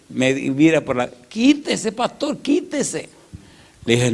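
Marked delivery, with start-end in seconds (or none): 1.1-3.1 the bandit yelling at the pastor to move; angry yell. Retold or imitated. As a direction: shout quítese twice at the pastor blocking the car. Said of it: "Y el tipo me dice".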